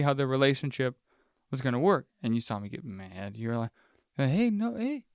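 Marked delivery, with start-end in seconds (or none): 4.2-5.0 mock soothing. Imitating partner calming him.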